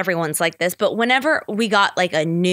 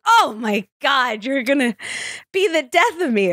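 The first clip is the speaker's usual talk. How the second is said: appalled mom; 4 semitones higher than usual. Retold or imitated.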